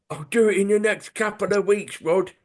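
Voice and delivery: high-pitched, put-on London villain accent; hammed-up London crook, voice pushed up. Charlie promises Rod a job, in a put-on London accent. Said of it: "threatening with a Brummie accent".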